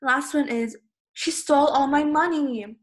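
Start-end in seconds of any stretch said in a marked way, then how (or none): 1.1-2.8 high, loud, upset accusation; emotional yell. Shown as a demonstration. Teacher acting out being robbed for an example, emotional yell. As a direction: cry out as if just robbed. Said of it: "yell out full of emotion".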